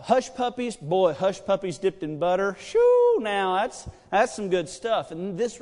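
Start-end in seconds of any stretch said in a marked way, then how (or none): 2.6-3.2 high, loud "Shoo" of pleasure; a loud happy squeal; delighted whoop. Real, not acted. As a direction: whoop at the thought of the food. Savoring hush puppies.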